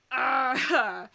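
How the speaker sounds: loud exasperated yelp; frustrated cry. Real, not acted. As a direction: let out a fed-up shriek. Grinder noise outside.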